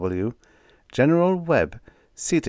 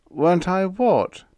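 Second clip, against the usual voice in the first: miserable, high-pitched bewilderment; lost and wretched, voice jumping up louder; 11 semitones higher, 5 dB louder than usual. A narrator's reading. Whitlow has lost the thread of the conversation, miserable. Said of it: "Whitlow asked miserably".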